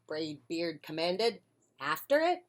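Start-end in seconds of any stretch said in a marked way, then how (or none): none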